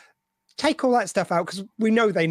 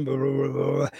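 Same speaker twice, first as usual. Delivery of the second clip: mumbled walla, lower than usual; background crowd chatter; 7 semitones lower than usual. Retold or imitated. Podcaster mimics background extras to co-hosts, low mock babble. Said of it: "extras and voiceover artists are going".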